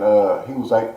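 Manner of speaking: usual speaking voice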